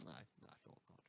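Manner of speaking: breathy whisper, voice dropped away; whispered aside. Acted. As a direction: mutter it under your breath to yourself. Dry aside after rhetorical question.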